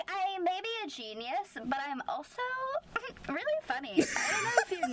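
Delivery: hammed-up pleased-with-himself goofball; Hall. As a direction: imitate a silly show-off. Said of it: "He's just a fun and quirky little man".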